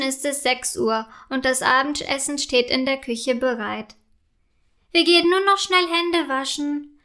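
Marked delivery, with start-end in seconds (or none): 4.9-7.0 lightly called, higher pitch; calling out. A narrator's reading. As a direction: call it out to someone, pitched up. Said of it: "ruft Paula". (rufend, mit höherer Stimme)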